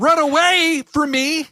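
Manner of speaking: high-pitched, hammed-up wrestling promo; MJF. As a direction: sneer it out, stretching the insult at the end. Acting out MJF's promo.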